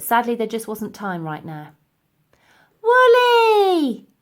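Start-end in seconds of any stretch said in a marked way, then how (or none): 2.8-4.1 high, loud call; cried out. A narrator's reading. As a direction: shout her name across the roof. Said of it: "cried the girl".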